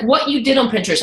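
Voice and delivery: normal talking voice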